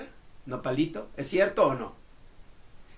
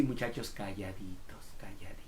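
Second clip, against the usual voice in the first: put-on whispered, as if telling kids to hush; a soft shushing whisper; 8 semitones lower, 16 dB quieter than usual. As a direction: breathe it out, almost no voice.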